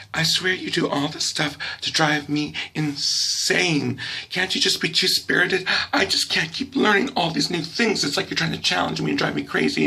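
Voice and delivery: high, worked-up complaining mom; mock motherly exasperation. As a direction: imitate a mother at her wits' end. Mother accuses child of driving her insane.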